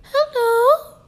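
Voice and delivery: high, squeaky mock hello; hammed-up squeaky hello; mock timid voice. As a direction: parody a nervous hushed greeting. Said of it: "I'm not whispering like".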